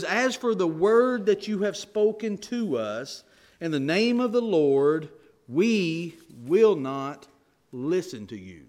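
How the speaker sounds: preacher voicing the people refusing Jeremiah, slow and defiant; defiant scripture quote. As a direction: let the refusal land slowly. Reads the people's defiant reply.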